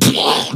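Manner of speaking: blown-out whoosh of impact; imitated crash noise. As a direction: mime the truck's impact with your mouth. Imitates the truck hitting him.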